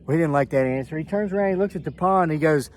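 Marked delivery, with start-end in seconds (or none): none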